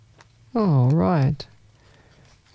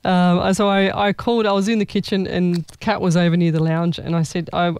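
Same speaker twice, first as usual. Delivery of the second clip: ordinary delivery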